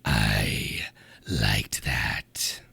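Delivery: deep unvoiced demon whisper; demonic whisper. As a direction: breathe it like a demon in the head. Shield voice speaks in head.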